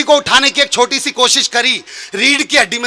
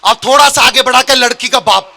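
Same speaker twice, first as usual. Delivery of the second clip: ordinary delivery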